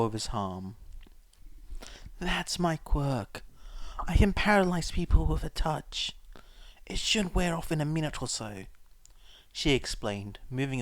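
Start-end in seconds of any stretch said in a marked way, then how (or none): 2.2-8.7 higher, hushed woman's voice, dropping to a whisper on the paralysis; scarred woman. A narrator's reading.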